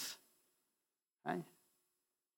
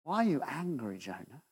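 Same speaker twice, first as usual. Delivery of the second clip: much softer, tender asking of Jonah; hushed gentle question; 13 dB quieter than usual. Acted. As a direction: ask him quietly, almost in a whisper. God's question repeated softly.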